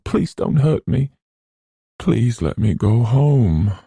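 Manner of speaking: high, tearful begging; sobbing plea. Acted. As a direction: plead to be let go home. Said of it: "after sobbing".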